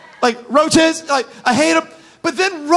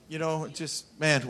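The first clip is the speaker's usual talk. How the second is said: ordinary talk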